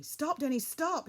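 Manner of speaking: loud, high urgent cry; shouting a warning. A narrator's reading. Father stopping his son from falling into the pit, shouting. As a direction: warn him sharply to halt. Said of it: "he shouted".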